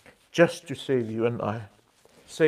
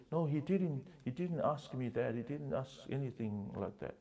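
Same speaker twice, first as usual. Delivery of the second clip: meek wife; 8 dB quieter than usual. Retold or imitated. Wife answering her angry husband.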